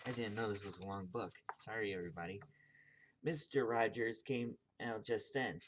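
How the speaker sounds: usual speaking voice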